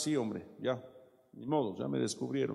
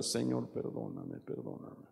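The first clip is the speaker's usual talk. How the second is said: soft, slow plea, the last "perdóname" fading into a breath; hushed repentant plea, almost whispered at the end; tearful prayer; 3 semitones lower, 8 dB quieter, slower than usual. Acted. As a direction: beg forgiveness quietly, as if about to cry. A llorar.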